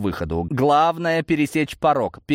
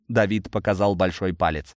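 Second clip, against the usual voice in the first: usual speaking voice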